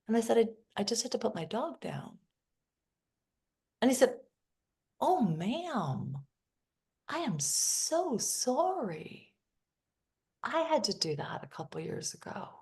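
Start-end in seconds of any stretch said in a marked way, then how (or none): 0.4-2.2 sad. Retold or imitated. Retelling telling of dead dog. 5.0-9.3 sympathy. Retold or imitated. Teller's sympathy retold.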